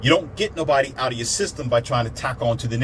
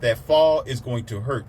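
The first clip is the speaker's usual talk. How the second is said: normal talking voice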